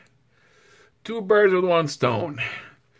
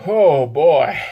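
ordinary talk, nothing marked

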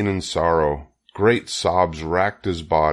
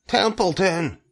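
high cry, no added volume; mild scream; 12 semitones higher than usual. A narrator's reading. Wilbur wakes the sleeping rat, mild scream. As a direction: call the name in a high, urgent voice. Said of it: "screamed Wilbur".

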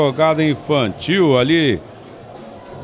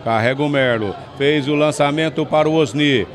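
normal talking voice

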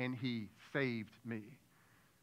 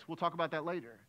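ordinary talk, nothing marked

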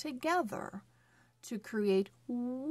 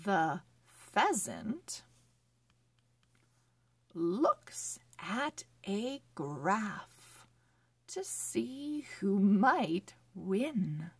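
slow, careful storybook reading; reading to children; slower than usual. Acted. Teacher reading a phonics story to children, slow and clear. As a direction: read each word clearly for young readers.

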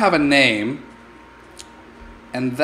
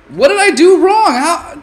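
high, loud frustrated cry; exasperated outcry; 9 dB louder than usual. Real, not acted. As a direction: throw up your hands vocally. Name lost again.

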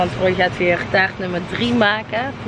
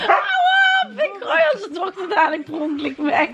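high, laughing shout; laughing protest; 12 semitones higher than usual. Real, not acted. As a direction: squeal while being tickled. Baby grabs her armpit.

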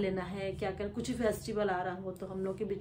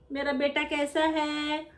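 imitating grandma; 6 semitones higher, 3 dB louder, slower than usual. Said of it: "करके पूछेंगे दादी".

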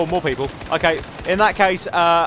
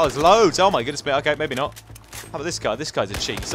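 ordinary talk, nothing marked

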